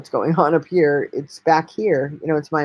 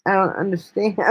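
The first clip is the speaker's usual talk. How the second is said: normal talking voice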